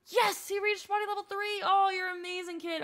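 loud, high cheer. Real, not acted. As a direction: celebrate the win. Celebrating potty level up.

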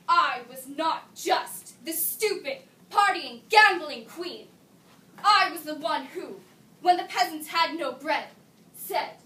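loud, slow, emphatic protest; defiant declaration. Acted. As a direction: proclaim it forcefully, punching each phrase. Monologue's defiant climax as queen.

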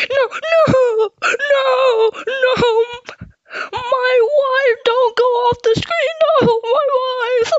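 very high, sobbing whine; distraught wailing. Acted. Fan begging his wife to stay onscreen, wailing. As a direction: wail it high like a heartbroken fan. Wailing as favourite leaves the screen.

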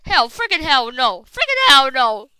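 loud, rising outcry; frantic loud refusal; alarmed shout. Real, not acted. Shouting in alarm during play.

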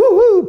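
a loud, high, forceful whoosh of breath; mock blowing sound. Teacher miming blowing sand into the sea, playful loud whoosh. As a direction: blow hard like scattering sand over the sea.

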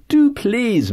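high, bright coaxing plea; eager coaxing. A narrator's reading. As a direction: coax her eagerly to sing. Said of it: "Missus Donnelly said".

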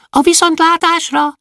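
high cheerful farewell; high goodbye. Acted. First dwarf waving farewell, high. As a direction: call goodbye high and bright.

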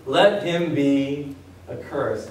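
drawn out, low and heavy; slow solemn curse; slow and grave. Shown as a demonstration. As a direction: let the curse land slowly. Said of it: "slow solemn reading".

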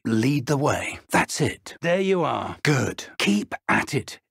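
normal talking voice

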